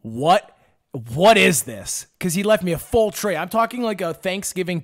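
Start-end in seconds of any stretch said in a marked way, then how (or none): 0.0-2.0 baffled. Retold or imitated. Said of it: "I go".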